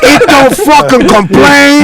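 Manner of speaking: high, slow, drawn-out mock inmate; hammed-up inmate voice. Retelling a cellmate's advice in his voice. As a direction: play the jailhouse guy selling his trick.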